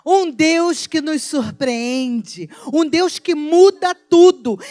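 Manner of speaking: slow, building to a loud shout; rising proclamation. Real, not acted. Pastor proclaiming to the church, building to a loud shout. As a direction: build from steady to a full shout. Preaching climax.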